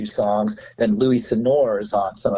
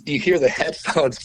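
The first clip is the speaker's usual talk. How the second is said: usual speaking voice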